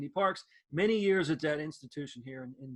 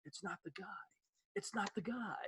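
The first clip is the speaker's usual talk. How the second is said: softer, breathy letdown aside; disappointed whisper; 16 dB quieter than usual. Retold or imitated. Boy realizes the clerk isn't the ad voice, whispering. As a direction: whisper it to yourself, crushed.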